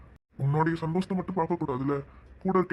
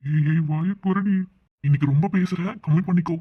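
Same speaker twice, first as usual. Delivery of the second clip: scolding; 6 dB louder than usual. Acted. Mother hushes cheeky daughter.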